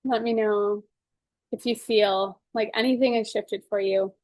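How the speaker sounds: calm, measured guided-session voice; guided tapping. Acted. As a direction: talk them gently through each tapping point.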